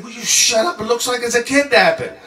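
high, urgent telling-off; hammed-up panicked scolding; retold exasperated yell. Traveler hushing sick friend at checkpoint, exasperated yell.

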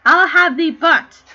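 loud, drawn-out shout; angry threat. Acted. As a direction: thunder it as an enraged king. Enraged king threatens to burn her.

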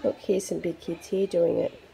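ordinary delivery, nothing marked

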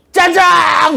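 high, drawn-out comic cry; hammed-up high holler; demonstrated shout. Shown as a demonstration. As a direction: call it out like a magic wish. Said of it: "외치면".

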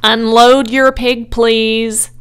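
loud, official public announcement; booming announcer giving an order; loudspeaker announcer. A narrator's reading. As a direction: call it out over the fairground. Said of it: "said the loudspeaker".

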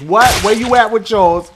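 normal talking voice

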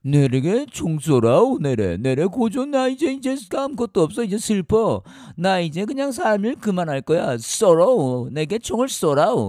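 high, loud North Korean melodrama; mock-despairing plea in a North Korean accent. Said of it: "북한에서".

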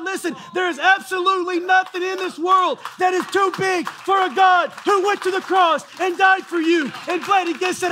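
high, loud preacher's shout; preaching at full force. Acted. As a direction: roar it out to the congregation.

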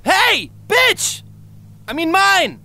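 high, loud panicked yelling across the room; frantic high yell for a vanished partner; calling out. Acted. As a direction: shout for her in alarm. Calling out to missing companion.

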